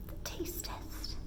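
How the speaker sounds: slow, hushed and mysterious; dramatic whisper. Acted. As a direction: whisper it like a big reveal.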